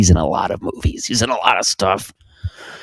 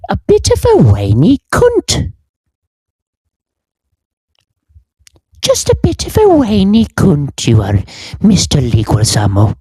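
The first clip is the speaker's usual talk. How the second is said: prim old British lady's mock rebuke, pitched way up; McGonagall voice; 10 semitones higher than usual. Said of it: "put on the voice of Professor McGonagall".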